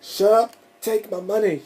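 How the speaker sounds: loud, high, thrilled outburst; excited shout. Retold or imitated. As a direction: shout it like the Fry meme. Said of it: "I was like / that Fry meme".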